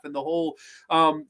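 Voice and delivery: usual speaking voice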